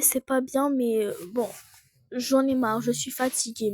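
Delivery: ordinary delivery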